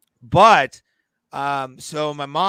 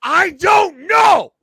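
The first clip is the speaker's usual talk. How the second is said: slow, very high, loud wail; exasperated shout; 10 dB louder, much slower than usual. Real, not acted. Host pressed by co-host on lost jersey, exasperated shout. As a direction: shout it helplessly, drawing out each word.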